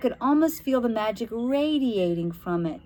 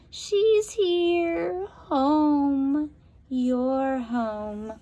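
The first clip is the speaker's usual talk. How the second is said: rasping, sly witch, higher; 6 semitones higher, much slower than usual. A narrator's reading. Witch Beryl gloats to the girl about home.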